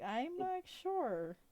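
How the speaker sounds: slow, lilting uncertainty; Rena, uncertain guardian. Acted. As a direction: stretch it out doubtfully to a child. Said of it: "Rena's like".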